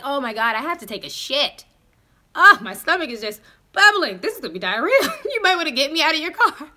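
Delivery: exaggerated fake stomach trouble, laughing; hammed-up sick excuse. Telling the date she is sick to escape, hammed-up. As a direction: ham up the diarrhea excuse.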